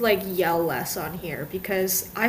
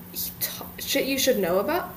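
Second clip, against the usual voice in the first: whispered; 5 dB quieter than usual. Real, not acted. Unsure of a podcast title, whispering to herself. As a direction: whisper it, unvoiced. Self-correcting title under breath.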